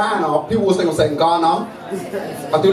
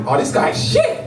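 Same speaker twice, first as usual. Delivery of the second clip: mocking friend. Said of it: "They told me".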